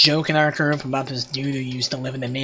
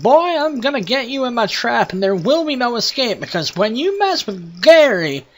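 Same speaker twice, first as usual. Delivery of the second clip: worked-up Southern rant, pitched high; southern accent; 8 semitones higher, 3 dB louder than usual. Retold or imitated. Imitating a prank-called Gary's threats in a high southern accent. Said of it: "decided Gary needed a southern accent".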